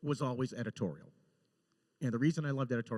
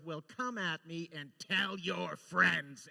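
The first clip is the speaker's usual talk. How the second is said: Janeway taunt; 5 semitones higher, 4 dB louder, much slower than usual. Retold or imitated. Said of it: "And she goes".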